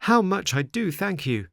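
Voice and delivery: high, eager little marionette voice; grateful puppet boy. A narrator's reading. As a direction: thank the dog in a high boyish voice. Pinocchio voiced in story.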